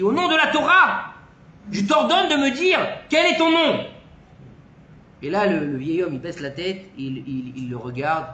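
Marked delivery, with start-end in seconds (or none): 0.0-3.9 ordre solennel, plus aigu et plus fort; il exige avec autorité et colère. Retold or imitated. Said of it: "le rav, il s'énerve et lui dit". (le rav ordonne au vieil homme, avec colère)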